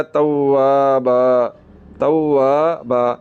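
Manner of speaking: توابا very slow, vowels drawn out; slow tajweed recitation. Shown as a demonstration. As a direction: recite توابا slowly, long aa. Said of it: "recitation demo".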